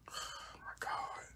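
breathless whisper under her breath; exasperated whisper. Real, not acted. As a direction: mutter it to yourself in a whisper. Frustrated over nails, under breath.

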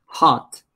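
"Hot" with an audible H; isolated teacher's demo of "Hot", H sounded. Shown as a demonstration. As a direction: say "Hot" on its own, breathing out the H. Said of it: "la H tiene sonido".

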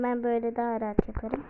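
ordinary delivery

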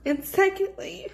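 crying through every word; snotty crying. Real, not acted. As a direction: voice breaking, high and teary. Tearful talk about infertility.

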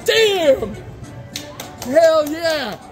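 high triumphant cheer; excited. Real, not acted. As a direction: celebrate the win. Celebrating grand jackpot.

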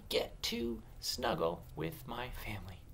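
soft, separated words for counting. Shown as a demonstration. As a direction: count out each word as you say it. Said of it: "How many words are in my sentence".

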